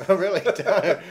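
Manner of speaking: loud, slow laughing. Real, not acted. Laughing denial.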